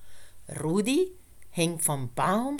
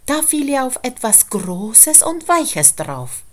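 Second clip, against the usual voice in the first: plain reading voice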